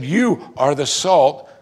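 slow, firm quote stressing YOU; emphatic assertion. Retold or imitated. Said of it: "He's saying it exactly the way it is".

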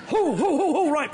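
loud, high, comic whoas; mock startled. Pastor pulled back quick from bad thoughts, mock startled. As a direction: jolt back as if caught out.

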